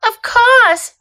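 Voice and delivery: put-on high, bright-spirited little girl's reply; loud, high, ready forgiveness; glad acceptance. A narrator's reading. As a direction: accept eagerly, pitched right up. Lucy gladly accepts the apology.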